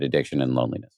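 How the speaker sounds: ordinary talk, nothing marked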